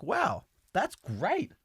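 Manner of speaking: drawn-out, high mock wow; acted amazement. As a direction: play the king mildly awestruck, slowly. King reacts to news, retold.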